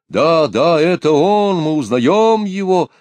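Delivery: high, loud joyful cries; excited shouting. A narrator's reading. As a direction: cheer in recognition. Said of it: "Раздались ещё другие голоса".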